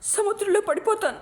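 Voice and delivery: high, pained anguished outburst; distraught crying out. A narrator's reading. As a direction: cry out in despair, voice climbing. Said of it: "అంటూ అరుస్తూ".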